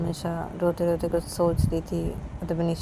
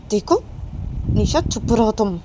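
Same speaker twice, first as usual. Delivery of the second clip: shouting hush; 4 semitones higher, 8 dB louder than usual. Acted. Manisha to Nisha, loudly ordering her to shut up. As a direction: yell at her friend to shut up.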